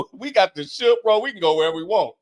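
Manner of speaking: high, loud playful boast; excited joking. Pretend. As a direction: hype it up like a joke. Joking role as movie hero.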